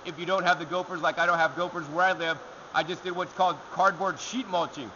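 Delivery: ordinary talk, nothing marked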